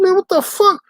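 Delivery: high, loud cry of disbelief; outraged disbelief. Retold or imitated. To the trainer, hearing the supervisor hates him: high outraged cry. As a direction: throw it up high, stunned. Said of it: "I said".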